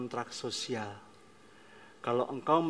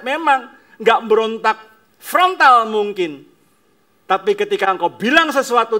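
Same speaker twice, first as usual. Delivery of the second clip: loud, higher, emphatic sermon; forceful preaching; 6 semitones higher, 14 dB louder than usual. Acted. Preacher answering parents' objection, loud and forceful. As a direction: hammer each point to the congregation.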